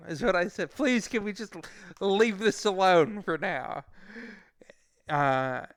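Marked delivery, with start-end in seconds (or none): 0.7-3.8 slow, higher-pitched plea; re-enacting his anxious request; nervous pleading. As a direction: beg to be left alone, drawing it out. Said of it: "I was very nervous".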